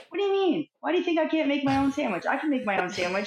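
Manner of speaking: high, fast indignant protest; defensive outburst. Acted. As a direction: snap back, offended. Acted defensive reaction to offer.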